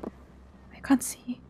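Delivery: a barely audible, slow whisper; fading into a slow whisper. Real, not acted. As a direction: breathe it out slowly, scared of being heard. Repeats it, even quieter.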